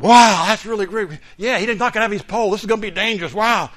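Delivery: loud, high, fast gasping wonder; mock amazed crowd. Crowd marvelling at the daredevil, loud excited wonder. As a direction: gush like an impressed onlooker.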